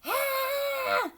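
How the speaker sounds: high loud yelp of pain; gorilla yell. Acted. Mommy gorilla bitten on the nose, loud high yelp. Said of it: "the gorilla yelled".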